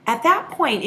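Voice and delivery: ordinary talk, nothing marked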